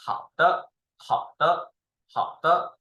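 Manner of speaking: teacher's model "hao de", stepping up on "de"; neutral tone going up. Shown as a demonstration. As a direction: say "hao de" with "de" lifted and brief. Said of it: "like this".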